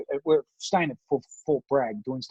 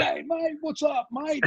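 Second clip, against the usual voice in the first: high-pitched, hammed-up Aussie greeting; strong Aussie accent; 12 semitones higher than usual. Aussie visitor greeting an armed local, strong Aussie accent. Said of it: "couldn't hear a stronger Aussie accent".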